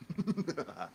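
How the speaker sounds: evil supervillain cackle; high, gleeful mad-villain cackle; unhinged villain. Pretend. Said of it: "I'd like to do my supervillain laugh".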